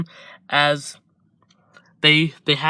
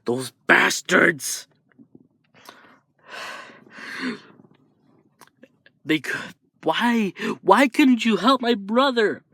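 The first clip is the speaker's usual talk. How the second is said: tearful anger; 6 semitones higher than usual. Acted. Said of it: "Izuku crying and getting angry".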